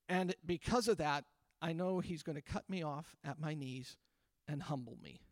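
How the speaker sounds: mock the fearful believer under your breath; hushed mock self-talk, expecting a fall; quiet self-doubting mutter. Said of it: "Do you know what we say?".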